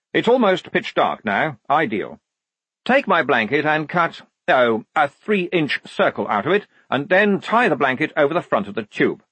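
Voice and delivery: officious lieutenant. Acted. Lieutenant instructing Igor on the blanket, officious. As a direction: give orders with brisk self-assurance.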